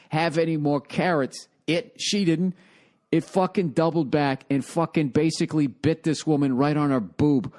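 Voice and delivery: ordinary delivery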